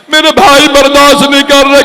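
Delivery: high, choked voice near tears; preacher breaks down as the mourners weep; voice breaking. Real, not acted. Choking up.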